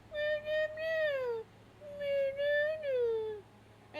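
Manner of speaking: high put-on crying meows; wailing kitten meows; meowing. The strange puppy meowing as it is put down, high meows. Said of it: "they hear".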